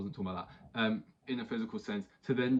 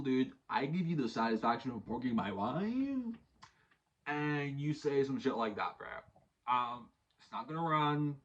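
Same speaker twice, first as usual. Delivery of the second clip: higher, slow, offhand dude voice; unbothered mock complaint; 4 semitones higher, slower than usual. The influencer calling out his wife's partner, casual mock-offended drawl. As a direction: imitate a guy faking being upset. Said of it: "wasn't even trying to act like he was actually pissed".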